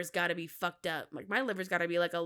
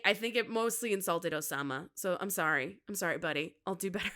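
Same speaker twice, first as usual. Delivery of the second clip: usual speaking voice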